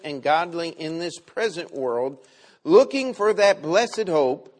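preacher reading Titus aloud; scripture reading. Acted.

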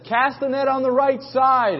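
high, slow, drawn-out call; calling across water. Retold or imitated. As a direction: imitate hollering from a far shore. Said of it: "They're shouting back and forth".

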